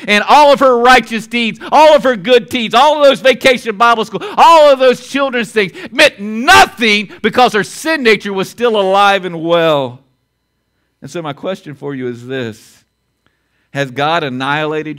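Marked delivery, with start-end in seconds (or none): 0.1-10.0 rising, louder preacher's build; preaching. Acted. Preacher driving the point home to the congregation, louder. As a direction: pound it home from the pulpit.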